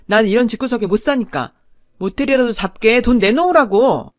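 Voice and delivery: put-on loud, high, contemptuous shouting at the boyfriend; furious yelling. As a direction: rage at being insulted, demand the money. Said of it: "화를 내더군요".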